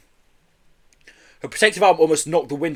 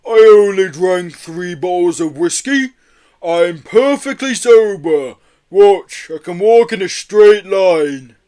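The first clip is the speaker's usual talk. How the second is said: loud, high, slow tipsy protest; drunken yeti; 5 semitones higher, 10 dB louder, slower than usual. A narrator's reading. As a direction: insist on sobriety in a big drawn-out drunken voice. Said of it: "You're drunk".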